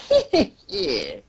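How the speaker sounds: slow, mock villain chuckle; put-on "hee hee hee"; villain laugh. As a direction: chuckle slowly like a scheming villain. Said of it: "Doflamingo's just laughing like".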